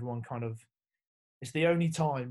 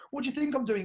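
higher, louder, disbelieving; incredulous retort; 12 semitones higher, 5 dB louder, faster than usual. Retold or imitated. Player snapping back at the coach, higher and incredulous. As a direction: throw the question back in disbelief. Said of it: "was like".

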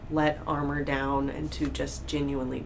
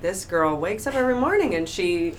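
higher-pitched send-up of perfection; mock admiration; 4 semitones higher, 6 dB louder than usual. Listener idealising her morning ritual, mock admiration. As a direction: build to a louder, gushing parody.

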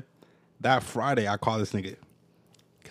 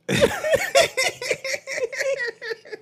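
long, high-pitched fit of laughter; high laughing fit. Real, not acted. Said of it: "we take a nice laughing break".

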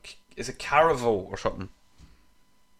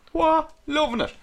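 excited; slower than usual. Retold or imitated. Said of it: "I was like".

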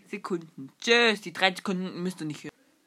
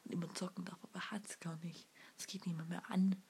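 whispering; 2 semitones lower, 14 dB quieter, faster than usual. Real, not acted. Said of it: "whispered gossip".

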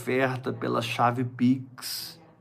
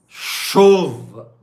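loud exclamation; 6 semitones higher, 11 dB louder than usual. Acted. Calling for a rain of offerings.

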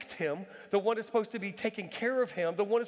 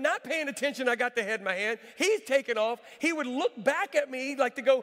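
ordinary delivery

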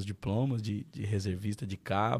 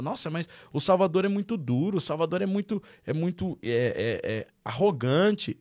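mock gossip; 7 semitones higher, 3 dB louder than usual. Neighbours criticizing strict father.

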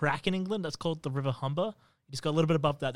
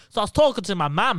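put-on Hull accent; 4 semitones higher, 6 dB louder than usual. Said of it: "Always be like".

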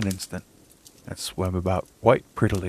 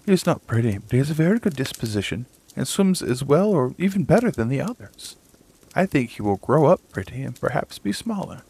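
higher, louder pleading mother; defensive mother duck; 6 semitones higher, 4 dB louder than usual. A narrator's reading. Mother duck defends her ugly son to the old duck, higher earnest voice. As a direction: stand up for your child, quick and earnest.

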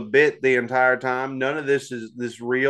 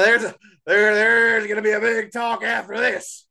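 shout; 7 semitones higher than usual. Real, not acted. Shouted announcement.

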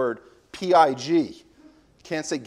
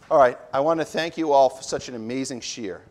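ordinary talk, nothing marked

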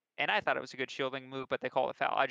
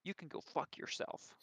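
growled; 13 dB quieter, slower than usual. Retold or imitated. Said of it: "growled it at me".

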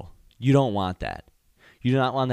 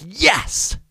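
high, loud, exultant yes; triumphant yell; 11 semitones higher, 6 dB louder than usual. Retold or imitated. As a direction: celebrate like you just scored. Retells exulting in awkwardness.

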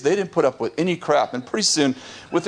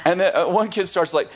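ordinary talk

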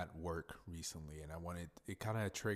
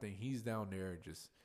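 ordinary talk

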